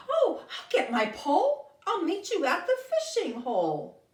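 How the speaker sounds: high, bright and eager, with a laugh; excited mole. Acted. Mole answers Joey's invitation, high and excited. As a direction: sound thrilled to go fishing. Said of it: "She seems excited".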